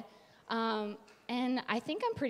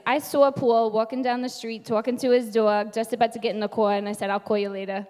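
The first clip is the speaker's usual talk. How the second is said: strong Long Island accent, louder; 7 dB louder than usual. Shown as a demonstration. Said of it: "Can you do that?".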